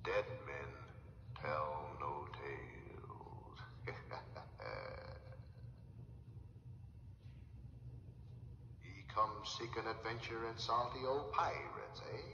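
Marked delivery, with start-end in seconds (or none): 3.6-8.8 low, breathy pirate chuckle; a menacing old pirate's laugh; sinister pirate laugh. Acted. Pirate laughs after warning.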